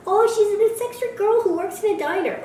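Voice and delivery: high-pitched mocking gush. Mockingly voicing the film's pitch.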